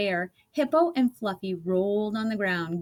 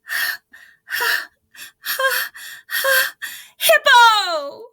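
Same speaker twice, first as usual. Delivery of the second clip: ha's rising from high to a very high, loud cry; hammed-up laughing fit building to a shriek; laughing out a name; 8 dB louder, much slower than usual. A narrator's reading. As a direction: laugh harder and harder until the name bursts out. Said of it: "gasped rhinoceros".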